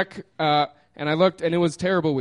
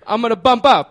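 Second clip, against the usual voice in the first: loud, high imitation of the Italian man; 6 semitones higher, 8 dB louder than usual. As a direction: call it out as a hammed-up Italian guy. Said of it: "almost the exact same thing happens again".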